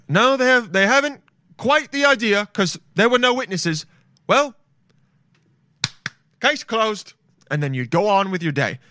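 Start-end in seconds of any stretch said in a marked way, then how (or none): 0.1-7.1 much higher, prim townsfolk voice; high old-timey neighbour. Pretend. Neighbour tells the murderer no witnesses, high prim mock voice. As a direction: play the fussy villager shrugging it off.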